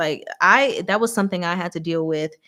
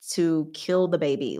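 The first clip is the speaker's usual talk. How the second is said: normal talking voice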